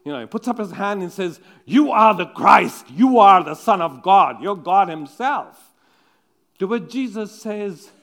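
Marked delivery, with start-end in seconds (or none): 1.6-5.7 proud declaration. Retold or imitated. Peter voiced answering proudly.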